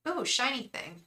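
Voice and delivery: playfully acting distracted by something shiny; a light joke about losing focus; mock distracted. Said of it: "I'm just kidding".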